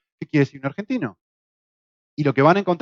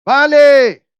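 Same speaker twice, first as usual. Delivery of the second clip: loud high shout across a building site; shouted call; 9 semitones higher, 9 dB louder than usual. Retold or imitated. Worker at the far end of the rope yelling, loud high shout. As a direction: yell it to a man far off on the rope. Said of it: "el hombre de la otra punta grita".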